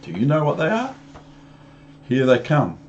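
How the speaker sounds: ordinary delivery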